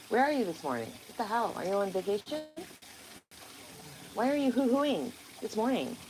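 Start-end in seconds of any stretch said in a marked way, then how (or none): none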